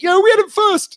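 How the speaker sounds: 高亢夸张的球迷口吻; mock football fan. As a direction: shout it like a defensive football fan.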